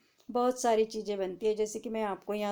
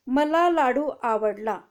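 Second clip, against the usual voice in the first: louder and slow, word by word; slow reading aloud; 5 dB louder, slower than usual. Shown as a demonstration. Teacher reading an example sentence to students, slow and loud. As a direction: read it out slowly for the class. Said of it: "आपको पढ़ के भी दिखाती हूँ".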